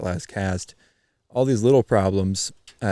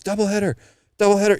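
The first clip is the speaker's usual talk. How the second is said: high-pitched excited holler, retold; mock boyish scream, pitched way up; imitated kid's shout; 12 semitones higher, 5 dB louder, faster than usual. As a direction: mimic a thrilled kid yelling from afar. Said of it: "I start hearing the kid shout".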